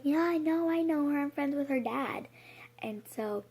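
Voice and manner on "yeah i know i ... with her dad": higher, louder, falsely friendly man; lightly voiced stranger, brightly insisting; mock stranger voice. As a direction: play the creepy guy all smooth and cheery. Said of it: "he's like".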